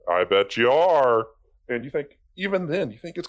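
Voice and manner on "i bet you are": slow, louder smug reply; mock God gloating slowly. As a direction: drawl it out, smug and knowing. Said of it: "God's like".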